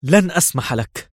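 high-pitched, straining defiance; struggling protest. A narrator's reading. Drugged Levi struggling to rise, straining defiance. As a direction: force the refusal out with effort. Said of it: "وحاول أن ينهض قائلا".

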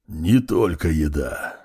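slow, low and measured; slow low undead voice. A narrator's reading. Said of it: "ответила высшая нежить".

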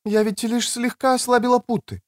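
high woman's voice, making excuses; excusing woman. Acted. Woman confessing about the ropes, high-pitched excuse. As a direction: excuse yourself in a high female voice.

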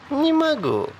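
very high, playful woman's voice; airy, unbothered female character; blithe refusal. A narrator's reading. Sally cheerfully refuses to stop.

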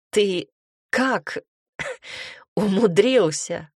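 husky voice choking on a laugh; holding back laughter. A narrator's reading. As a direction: barely keep the laughter in. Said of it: "сиплым от сдерживаемого хохота голосом".

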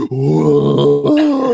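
hammed-up movie gasp then a loud whoa; mock gasp and whoa. Mocking Nicolas Cage's sword-swinging whoa. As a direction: ape the hero's startled cry. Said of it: "running around with a sword going".

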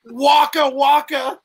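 loud, high, stretched-out catchphrase; high cartoon comedian bear, drawn out and punchy; Fozzie Bear. Host echoing a kid's joke punchline, high comic bear voice. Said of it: "your best Fozzie Bear voice".

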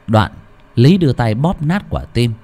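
ordinary delivery

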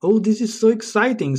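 high-pitched fan excitement; excited. Acted. Interviewer hearing Bond must go to Q, high excited gush. Interviewer thrilled about meeting with Q.

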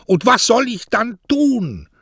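desperate high shout, slow. Acted. Postman shouting his despair at the poet, high and loud. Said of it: "schrie Mario".

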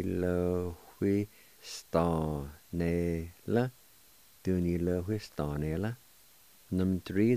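usual speaking voice

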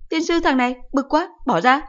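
high, loud exasperated scolding; cursing mother. Acted. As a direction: mom swearing at her son in the way. Mother curses clingy son.

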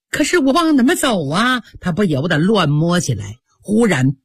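plain reading voice

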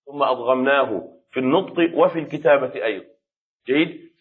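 ordinary talk, nothing marked

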